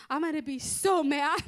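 loud, comic mock anger; hammed-up threat, ending in a laugh; retold worked-up threat. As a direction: raise the voice as the worried wife. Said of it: "She was terrified".

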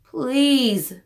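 mild pleading please; begging. A narrator's reading. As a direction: coax him with a little plea. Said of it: "begged the bird".

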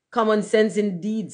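pompous, affronted storybook rocket; indignant. A narrator's reading. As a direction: scoff with wounded self-importance. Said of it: "said the rocket indignantly".

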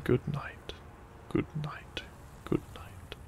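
plain reading voice

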